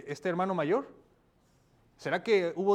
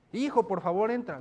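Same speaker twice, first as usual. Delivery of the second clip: higher, louder begging; pleading father; 6 semitones higher, 4 dB louder than usual. Acted. Father pleads with his angry son, begging. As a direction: beg him one more time, earnestly. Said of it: "Eso es rogar".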